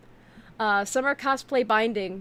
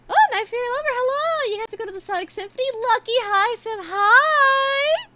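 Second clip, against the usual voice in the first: high, bright hello to chat; excited greeting; 7 dB louder than usual. Real, not acted.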